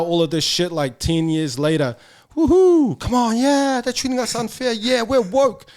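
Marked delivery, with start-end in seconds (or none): 2.3-5.7 much higher, louder hammed-up rallying voice; mock woke activist. Mocking latecomers rallying for island rugby, hammed-up. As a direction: parody eager late protesters.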